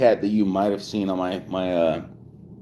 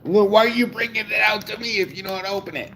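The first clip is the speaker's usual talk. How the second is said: higher, rushed scolding; real exasperation; 11 semitones higher, faster than usual. Real, not acted. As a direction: fire back an exasperated question, high and fast. Scolding kid who interrupted.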